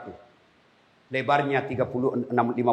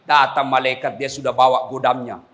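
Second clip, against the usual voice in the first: normal talking voice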